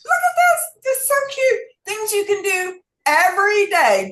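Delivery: high, loud, gushing over something cute; delighted gushing. Real, not acted. Host showing viewers a tiny book, gushing high and loud.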